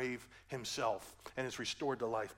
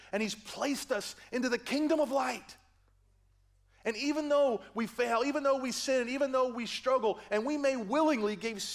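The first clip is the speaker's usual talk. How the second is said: full-force preaching; 10 semitones higher, 10 dB louder than usual. Acted.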